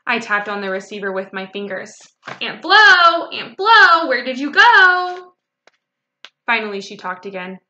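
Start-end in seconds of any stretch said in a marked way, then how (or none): 2.4-5.3 high, loud sing-out down the phone; drawn-out childish call. A narrator's reading. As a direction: holler for someone who has gone silent. Child calling into silent phone.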